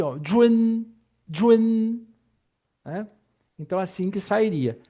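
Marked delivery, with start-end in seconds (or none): 0.2-2.0 jun with a faint E before the n, high; model syllable with hidden E. Shown as a demonstration. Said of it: "Então ficaria mais ou menos assim". (jun com um E discreto)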